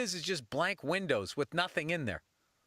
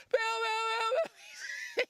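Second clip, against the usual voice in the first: very high squealing calls; high kid yelling; much slower than usual. Retold or imitated. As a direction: squeal his name through the fence. Kid yelling at the exit fence.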